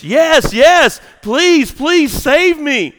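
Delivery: high, loud pleading from afar; crying out. Acted. Hiker on branch begs to be saved, crying out. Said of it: "cries off from the branch".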